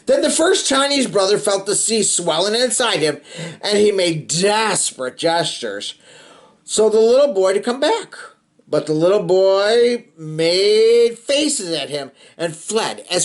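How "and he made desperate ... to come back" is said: plain reading voice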